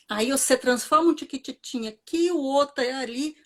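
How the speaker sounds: ordinary talk, nothing marked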